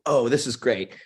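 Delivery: normal talking voice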